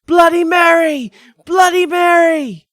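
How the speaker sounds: high, loud scream of the name. A narrator's reading. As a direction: scream it as the door gives.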